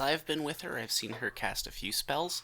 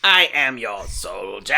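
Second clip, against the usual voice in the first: slow, loud robotic soldier voice; 3 semitones lower, 12 dB louder, slower than usual. Acted. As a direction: speak slow like a loyal robot. Robot character speaks.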